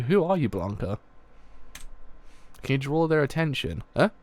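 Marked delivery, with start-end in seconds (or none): none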